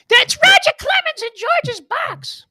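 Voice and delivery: very high, loud falsetto impression; over-the-top screechy announcer parody; Susan Wallman. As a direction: shriek it slowly in a mock excited falsetto. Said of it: "my, like, my Susan Wallman impression".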